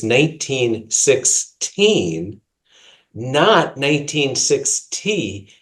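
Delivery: slow, deliberate, every syllable clear; careful enunciation. Real, not acted. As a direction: spell it out slowly and carefully. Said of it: "I'm gonna have to be careful to, uh, enunciate".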